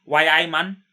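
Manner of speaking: half-joking Geordie accent impression; mock Geordie accent. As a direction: toss off a Geordie "Why I man" like a local. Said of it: "they speak with this very weird accent".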